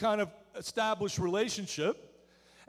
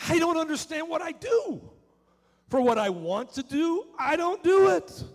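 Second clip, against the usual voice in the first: anguished shout; 5 semitones higher, 4 dB louder than usual. Acted. Preacher voicing Paul's anguish.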